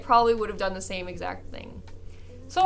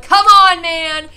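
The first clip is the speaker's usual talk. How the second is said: loud, high-pitched outburst; big loud complaint, re-enacted; retold exasperated shout; 6 semitones higher, 13 dB louder, slower than usual. Retold pleading shout at someone.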